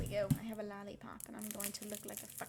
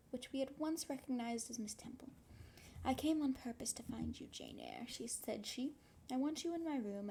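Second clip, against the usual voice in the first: story reading; 2 semitones higher than usual. Acted. Reading novel aloud on stream.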